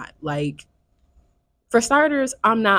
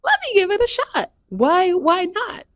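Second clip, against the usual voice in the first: breezy inner thought; 9 semitones higher than usual. Retold or imitated. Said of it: "I just figured".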